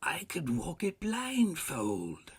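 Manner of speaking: high, loud, drawn-out exclamation; fervent cry. A narrator's reading. Scrooge boasting he knows the way, fervent cry. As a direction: stretch it out with fervor. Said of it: "cried Scrooge with fervor".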